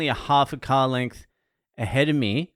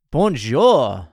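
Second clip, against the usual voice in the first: louder, hammed-up French "Bonjour"; mock French bonjour; 6 dB louder than usual. Greeting a Patreon member in France, a mock French hello. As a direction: toss a cheesy French greeting.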